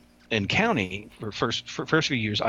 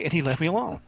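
ordinary delivery

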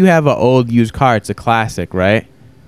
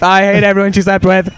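mocking impression; 6 semitones higher than usual. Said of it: "Oh, here comes my wife".

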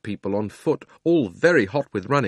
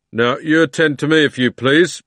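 london policeman; 5 semitones higher, 7 dB louder than usual. A narrator's reading. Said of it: "said the policeman".

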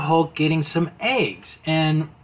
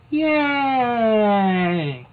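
higher crowd cheer; light hammed-up yay; mock cheer; 7 semitones higher than usual. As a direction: cheer like a bystander. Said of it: "the people are going".

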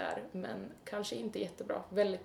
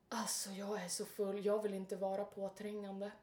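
hushed, half-whispered excuse; quiet drunken apology; 3 semitones lower, 6 dB quieter than usual. Retold or imitated. Drunk man excusing himself to a stranger in the queue, hushed apology. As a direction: sidle up softly and apologetically.

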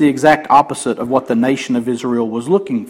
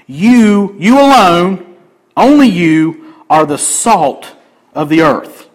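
"You" and "alone" punched louder, "only you" slowed; emphatic quote; 4 dB louder, slower than usual. Shown as a demonstration. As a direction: proclaim each "you" like a pointing finger. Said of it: "in this sentence is emphatic".

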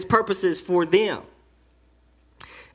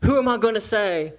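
usual speaking voice

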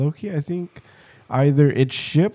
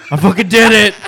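triumphant shout; 8 semitones higher, 13 dB louder than usual. Real, not acted. Triumphant after chugging beer.

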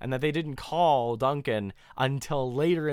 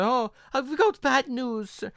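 hint of a worried maid; lightly voiced housekeeper; 10 semitones higher, 4 dB louder than usual. Housekeeper breaking bad news to Duncan, hesitant.

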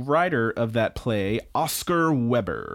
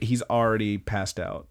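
usual speaking voice

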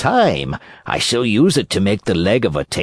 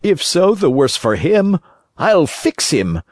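frightened carpenter; 9 semitones higher than usual. Acted. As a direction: puzzle it out half scared, then threaten. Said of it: "trembling and stuttering from fright".

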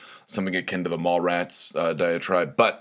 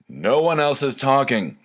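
higher, emphatic comeback; retold exasperated retort; 6 semitones higher, 4 dB louder than usual. Host answering his friend's denial of the recording, retold exasperation.

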